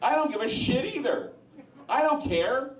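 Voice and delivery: high amused disbelief through laughter; laughing scoff. Retold or imitated. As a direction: laugh it off as if the answer is obvious. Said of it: "And I was laughing, going".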